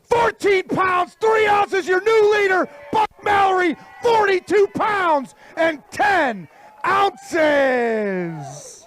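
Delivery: high, booming leader announcement, last words drawn out; announcer shouting. Acted. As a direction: shout the new leader to the crowd.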